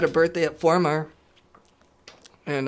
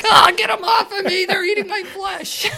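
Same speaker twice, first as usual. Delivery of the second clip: high, loud panicked shriek; hammed-up terrified scream; mock screaming; 8 dB louder than usual. Mock screaming bugs on body.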